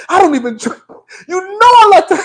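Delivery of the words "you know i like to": high, singsong mock quote. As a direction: mimic her in a raised pitch. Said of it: "Ain't that what [name] said?".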